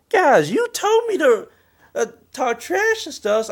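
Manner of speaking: high protest. Retold or imitated. Player protesting to the board that scolds him, high whiny protest. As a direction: plead your case in a squeaky voice.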